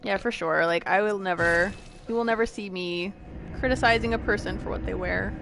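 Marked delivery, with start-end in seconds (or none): none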